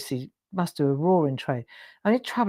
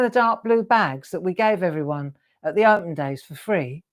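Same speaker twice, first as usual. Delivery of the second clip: ordinary delivery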